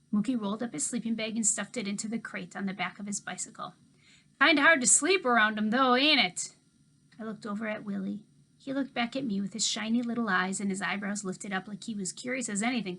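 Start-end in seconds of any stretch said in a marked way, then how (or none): 4.4-6.6 put-on easygoing old man talking to kids; Mookie's voice. A narrator's reading.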